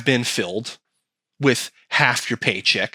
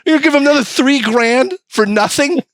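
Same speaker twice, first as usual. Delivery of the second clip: high, loud incredulous mock voice; outraged forefathers; 10 semitones higher, 4 dB louder than usual. Forefathers asking why we pay tax for nothing, high and outraged. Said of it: "They're going".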